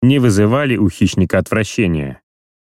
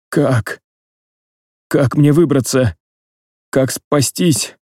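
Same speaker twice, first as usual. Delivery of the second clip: desperate; 5 semitones higher, slower than usual. Acted. Dying man panics.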